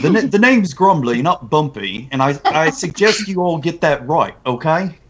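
touchy London heavy; British accent. Acted. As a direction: correct them firmly in a London accent. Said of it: "like, a British accent".

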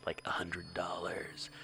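whispered aside. Real, not acted. Joking aside about big donation.

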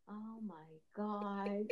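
soft, very slow exasperated mutter; weary mock dismay. Mother in recliner must get up, weary dismay. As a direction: drag it out quietly, as if burdened. Mother groans at having to rise.

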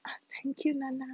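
soft unvoiced thank you; whispered thanks. Real, not acted. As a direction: breathe thanks to Nana under the mic.

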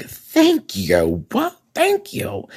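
a delighted, vindicated cry of thanks; excited thank you. Retold or imitated. To Lorraine Bracco's old article, excited high thanks. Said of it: "So I'm like".

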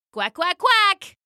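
high, slow duck quacks; quacking duck. Acted. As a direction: quack out a marching refrain. Duck's quacking refrain.

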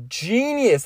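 ordinary talk, nothing marked

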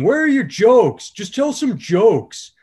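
put-on high, loud, slow drunken heckler yell; heckler yells again. Drunk heckler repeats his demand to the comedian, high loud yell. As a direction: shout it from the back, dragged out. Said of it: "he yelled out again".

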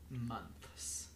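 normal talking voice

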